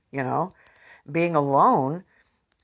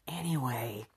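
breathy whispered aside; 2 semitones lower, 11 dB quieter than usual. Real, not acted. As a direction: drop to a whisper.

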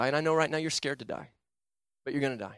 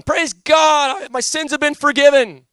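much higher, louder, gushing praise; a hammed-up shout of praise he did not feel; mock elation; 10 semitones higher, 10 dB louder, slower than usual. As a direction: imitate a jubilant new convert. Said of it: "I didn't walk away going".

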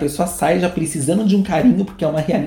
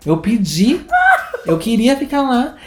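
imitating the son; 7 semitones higher than usual. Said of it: "Ele faz".

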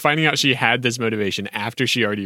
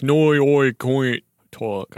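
slow "noi oi coin talk", oi for I and noi for now; hammed-up "noi oi coin talk"; mock accent; much slower than usual. Shown as a demonstration. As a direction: stretch the odd vowels. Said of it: "so oi coin talk".

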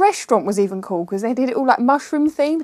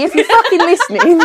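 laughing, louder and higher; 5 semitones higher, 6 dB louder than usual. Real, not acted. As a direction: laugh through the jab. Laughing jab at the complainer.

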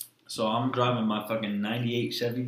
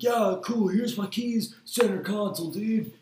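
laid-back bro; 9 semitones higher than usual. Retold or imitated. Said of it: "He was like".

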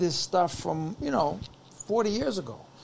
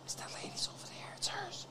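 secretive whisper, imitating a friend; hushed, voiceless aside; 18 dB quieter than usual. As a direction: whisper it as a discreet tip-off. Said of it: "My friend whispers to me".

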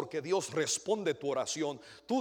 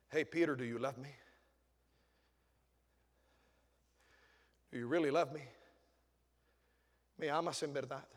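a hint of Jesus, soft and lower; gentle Jesus; 4 semitones lower, 5 dB quieter than usual. Jesus asking Peter if he loves him, softly. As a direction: ask it softly, like a tender question.

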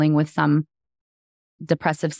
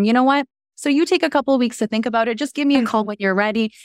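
high and fast, nervous; nervously rushing the offer; higher nervous voice; 7 semitones higher, faster than usual. Retold or imitated. Retold nervous offer to husband.

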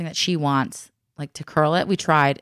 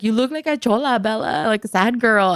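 teasing dad; 6 semitones higher, 4 dB louder than usual. Retold or imitated. Husband teasing daughter's eyebrows.

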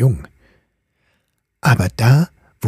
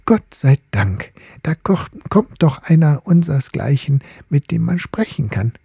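lightly voiced, higher, relieved old ball; a hint of a faded lady glad of company; 7 semitones higher than usual. The old ball greeting the gilded top, lightly relieved.

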